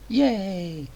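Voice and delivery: high, put-on "yay"; mock cheer. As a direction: cheer with fake enthusiasm. Mock cheer for dreaded poetry.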